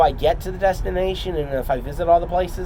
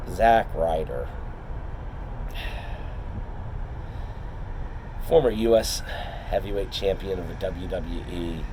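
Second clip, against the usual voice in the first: wrestling promo; 5 semitones lower, 3 dB quieter than usual. Acted. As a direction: call out your rival like a wrestler. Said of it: "So I'm gonna cut a promo".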